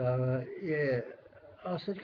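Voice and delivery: ordinary talk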